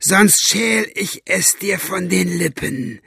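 high, strained, slow menace; word-by-word furious threat; screamed threat. A narrator's reading. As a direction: grind out the threat in rage. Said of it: "schrie Buster".

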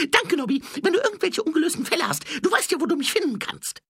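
very high, loud, fast put-on voice; grateful petty thief. A narrator's reading. Thief offering himself for unsolved cases, high fast shout.

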